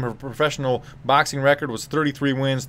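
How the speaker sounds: ordinary delivery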